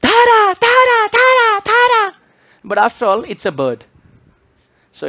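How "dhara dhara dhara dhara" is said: very high, loud squawking parrot; high loud parrot chatter. Retold or imitated. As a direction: screech it like a parrot repeating a word. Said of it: "Parrot said".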